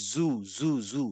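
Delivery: জু with a buzzing z and air escaping; low soft জু with airflow; breathy z demo. Shown as a demonstration. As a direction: let air flow out on জু. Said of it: "মুখ দিয়ে একটা বাতাস বেরোয়".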